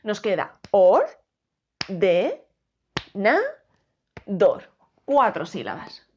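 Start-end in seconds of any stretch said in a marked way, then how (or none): 0.0-4.7 "or", "de", "na", "dor" hit loudly with pauses; loud, spaced syllable drill. Shown as a demonstration. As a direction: tap out each beat of "ordenador". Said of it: "si la dividimos en sílabas".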